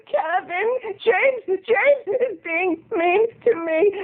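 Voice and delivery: high, drawn-out mock whimper; imitating a whiny tearful starlet; crying young lady. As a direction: blubber it high and pitiful. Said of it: "she was crying, and she was going".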